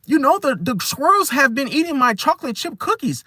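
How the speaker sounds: high, rambling nervous voice; over-explaining. Retold or imitated. Nervous person over-explaining missing cookies in a high voice. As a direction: babble on, explaining too much. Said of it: "over-explaining basic things".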